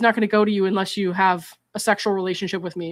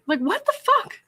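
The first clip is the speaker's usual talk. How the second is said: high incredulous squeak; shocked, pitch jumps way up; stunned outraged disbelief; 12 semitones higher than usual. Real, not acted. Reacting in disbelief to messages.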